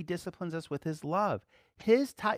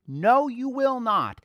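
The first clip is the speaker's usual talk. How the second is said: slow, loud, much higher flat denial; emphatic no; 7 semitones higher, 8 dB louder, much slower than usual. Acted. Preacher rebuts anger's lie with a slow shout. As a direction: hammer each word home.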